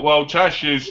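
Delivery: ordinary talk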